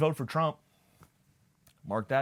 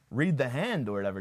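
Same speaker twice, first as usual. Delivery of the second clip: usual speaking voice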